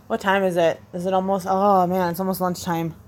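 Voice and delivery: usual speaking voice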